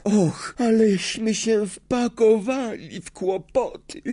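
very high, anxious wail; horrified dismay. A narrator's reading. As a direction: lament in panic, high-pitched. Hermiona horrified after attacking teacher. (przerażony, wysoki lament)

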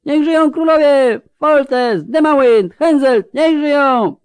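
very high, loud shouted vivats; roaring crowd cheers. A narrator's reading. Crowd hails commanders and kings at the parade, roaring vivats. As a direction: hail each name at full voice. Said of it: "Tłum ryczał, wiwatował".

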